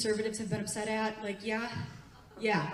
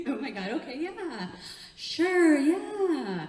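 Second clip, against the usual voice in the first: high giggle into a drawn-out "sure"; hammed-up giddy acceptance; giggly flirty yes; 4 semitones higher, 3 dB louder, slower than usual. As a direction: gush yes through giggles, acting thrilled. Acts out her flirty reply.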